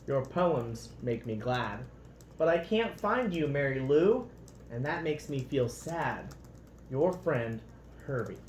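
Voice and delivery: lively, expressive storybook read-aloud; reading to children. A narrator's reading. Parent reading a picture book to children, lively storybook voice.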